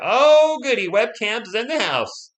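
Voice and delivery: a high, loud yelp, then slow sarcastic cheer; mock delight. Streamer mocks webcams showing up, with sarcastic glee. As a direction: fake glee, drawn out slowly.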